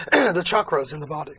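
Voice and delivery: rough throat just after clearing it; hoarse voice. Real, not acted. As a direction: push words out past a failing voice. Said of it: "I'm losing my voice here".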